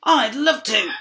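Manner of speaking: high, loud happy frog; croaking frog. A narrator's reading. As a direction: croak it gladly. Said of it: "croaked the frog".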